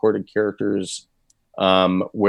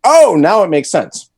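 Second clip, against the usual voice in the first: higher, louder dawning realization; an imitated aha moment, pitched up and louder; mock lightbulb moment, voice jumping up and louder; sudden realization; 9 semitones higher, 7 dB louder than usual. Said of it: "you're like".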